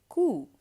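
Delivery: "Cou" as a loud, high koo; same oo sound. Shown as a demonstration. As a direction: model "Cou" with a clear oo vowel. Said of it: "it's the exact same sound".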